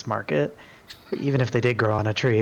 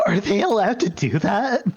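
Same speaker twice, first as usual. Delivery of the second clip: lightly voiced robot, higher and bewildered; a hint of a baffled machine; confused robot; 9 semitones higher than usual. Robot asking in confusion, higher voice.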